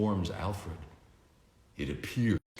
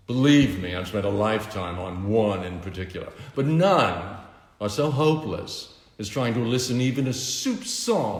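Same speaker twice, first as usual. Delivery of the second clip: louder, self-satisfied tycoon voice; smug villain; 2 semitones higher, 6 dB louder than usual. A narrator's reading. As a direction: lord it over them with smug charm. Lex Luthor mocking Bruce.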